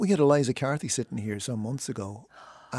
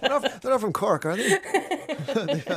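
high, laughing question; laughing while talking; 12 semitones higher, 4 dB louder, slower than usual. Real, not acted. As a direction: laugh through the words. Host laughing at his mistake.

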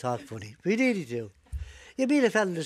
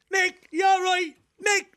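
high shouted call down; 6 dB louder, slower than usual. Retold or imitated. Paddy shouting down to Mick underwater. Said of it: "He shouts out".

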